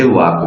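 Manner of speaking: long open vowel straight into the K in वाक; silent L. Shown as a demonstration. Teacher showing learners how walk is said, silent L. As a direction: say वाक with no L sound. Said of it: "एल silent रहता है".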